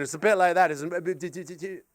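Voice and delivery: normal talking voice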